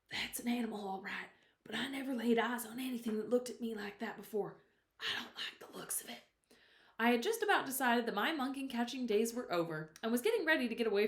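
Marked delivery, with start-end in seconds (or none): 0.1-6.2 frightened hushed whisper; shaky whisper. A narrator's reading. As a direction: whisper it, voice trembling. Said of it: "I whispered in a shaky voice".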